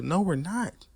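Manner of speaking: ordinary delivery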